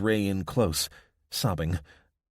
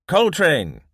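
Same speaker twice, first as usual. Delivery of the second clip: high, loud call to the dog; harsh call; 9 semitones higher, 9 dB louder than usual. A narrator's reading. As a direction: bark the dog's name from the cottage. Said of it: "a harsh voice called".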